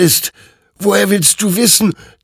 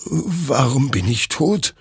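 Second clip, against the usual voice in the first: bewildered dismay; 8 semitones higher than usual. Acted. Soldier sees his own corpse.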